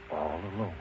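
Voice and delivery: ordinary delivery, nothing marked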